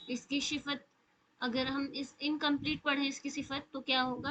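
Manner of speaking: ordinary talk, nothing marked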